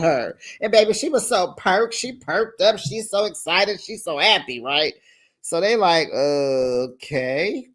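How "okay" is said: drawn-out, questioning reaction, rising at the end; skeptical. Retold or imitated. Friends hearing she is excited about his call, mock skeptical. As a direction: mimic wary friends, stretching it out slowly.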